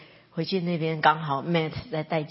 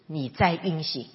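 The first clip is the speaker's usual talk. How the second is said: normal talking voice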